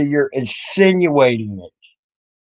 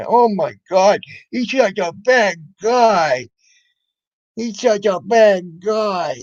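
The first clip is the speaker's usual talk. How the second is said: slow, slightly higher mock crybaby whine; mock whining; 4 semitones higher, slower than usual. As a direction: parody her sulking, drawn out. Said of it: "huffy and puffy and crying".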